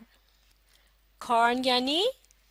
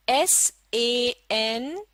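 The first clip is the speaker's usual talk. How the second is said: very slow, each letter apart; slow spelling; much slower than usual. Shown as a demonstration. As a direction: spell out the first part. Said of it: "आप गौर से सुनिए". (धीरे-धीरे अक्षर बोलना)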